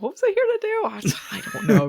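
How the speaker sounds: high puzzled question, then giving up; lightly hammed-up bewilderment; mock confusion. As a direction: play forgetful and baffled. Voices confused possessed character at door.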